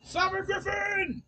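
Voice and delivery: high, drawn-out loud holler; shout. Real, not acted. Player hollers out mid-game, high long shout. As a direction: belt it out, stretching it. Calls out suddenly over the game.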